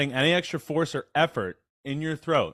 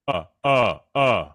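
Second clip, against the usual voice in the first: low, louder grunts from the chest; 3 semitones lower, 5 dB louder, slower than usual. Shown as a demonstration. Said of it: "just go like this".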